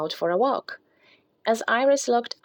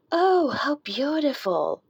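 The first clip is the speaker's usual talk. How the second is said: high, slow, awed gasp of delight; exclaiming in wonder; 7 semitones higher, slower than usual. Acted. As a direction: marvel at the first snow. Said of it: "Iris exclaimed in wonder".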